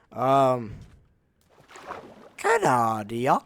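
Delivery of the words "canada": loud, drawn-out mock French accent, rising at the end; botched French accent. Gamer retrying the accent for a joke, badly faked French. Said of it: "not a French accent either".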